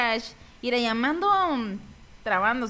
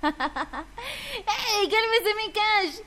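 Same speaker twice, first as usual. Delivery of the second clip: slow, higher, laughing through the words; giggling "calm down"; 5 semitones higher, slower than usual. Real, not acted. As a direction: tease him while laughing. Laughing at a stammering friend.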